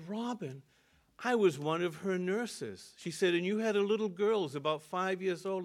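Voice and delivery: a nurse suddenly realizing who he is; stunned, then eager, in the nurse's voice; surprised recognition. Retold or imitated. Nurse recognizes his late wife.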